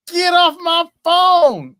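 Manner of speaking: high, loud, slow mock shout; drawn-out mock yell; imitating a radio host's yell. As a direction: bellow it like a DJ kicking a caller. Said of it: "That's what Godfather says".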